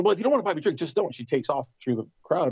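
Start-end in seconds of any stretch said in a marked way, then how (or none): 0.0-1.1 higher-pitched mock huffiness; put-out retort, pitched up but not shouted; retold annoyance. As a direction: imitate a miffed woman brushing someone off. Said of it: "she got really mad at me".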